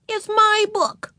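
put-on very high, slow little-girl insistence; stubborn. A narrator's reading. Ramona defying Mother over the book, stubborn and high. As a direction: dig in like a stubborn small child. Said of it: "said Ramona stubbornly".

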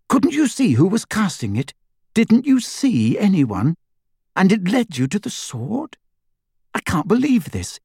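breathless eager schoolgirl; young girl voice, urgent. A narrator's reading. Hermione's voice, eager questions.

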